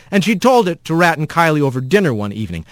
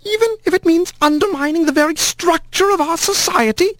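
put-on hateful old aunt. A narrator's reading. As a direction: spit out contempt without raising the voice. Sour aunt Meline voiced.

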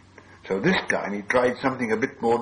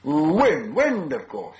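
raised, delighted exclamation; triumphant answer; 6 semitones higher, 4 dB louder, much slower than usual. A narrator's reading. Bilbo getting the answer, pleased exclamation. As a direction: call out the answer with sudden relief.

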